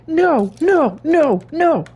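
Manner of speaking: high, loud hammed-up "no, no, no"; laughing then shrieking high in mock horror; mock despairing cry. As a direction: wail in melodramatic despair. Mock despair at game mistake.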